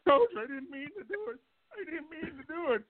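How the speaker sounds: high, whiny mock sob voice; imitating crying plea. Player crying to the coach, mock sobbing plea. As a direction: plead like a crying kid caught out. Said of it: "he starts crying".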